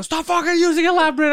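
loud, high angry yell; a retold angry shout at a friend. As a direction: yell at him to stop. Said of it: "And I started yelling".

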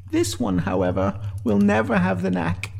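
mildly dry aside, rising high; dry reflection. A narrator's reading. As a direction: remark dryly in a lifted voice. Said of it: "Sinead reflected, dryly".

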